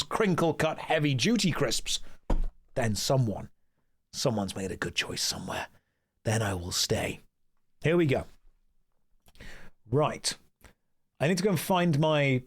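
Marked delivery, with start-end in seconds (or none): none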